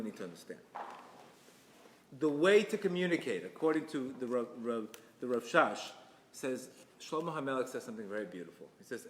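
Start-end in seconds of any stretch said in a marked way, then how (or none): none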